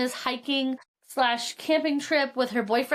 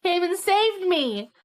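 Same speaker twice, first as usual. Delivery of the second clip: excited texting; 6 semitones higher, 3 dB louder than usual. Retold or imitated. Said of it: "and she's like".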